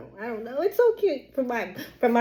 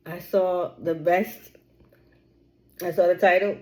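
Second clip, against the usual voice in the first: ordinary talk, nothing marked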